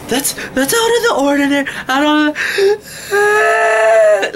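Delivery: high, fast mocking whine; whining fans. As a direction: mimic complainers in a squealing rush. Mocking fans who bitch.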